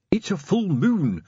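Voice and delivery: ordinary delivery, nothing marked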